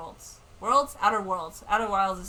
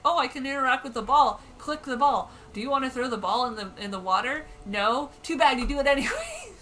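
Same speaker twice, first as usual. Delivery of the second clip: loud, higher-pitched mock game prompts; animated retelling; 5 semitones higher, 9 dB louder than usual. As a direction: act out the silly exchange loudly.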